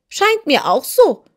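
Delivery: high, loud sneer; contemptuous. Acted. The hen sneers at the kitten, high and scornful. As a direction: toss it off with scorn, pitched high. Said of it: "sagte Belina verächtlich".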